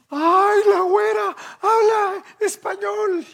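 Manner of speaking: very high, loud squeal of surprise; mock amazement. Coworkers caught gossiping about the blonde granddaughter, squealing in mock surprise. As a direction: shriek it like startled gossiping coworkers.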